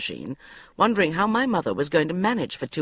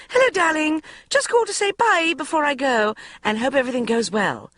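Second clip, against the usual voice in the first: high, loud, breezy mum; high-pitched mother saying goodbye; gushing mother; 11 semitones higher, 4 dB louder than usual. A narrator's reading. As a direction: sing it out like a flustered mother.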